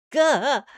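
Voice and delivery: high, loud startled gah; sudden loud cry of shock; startled yelp. Acted. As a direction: yelp as you're dragged out. Yanked into corridor.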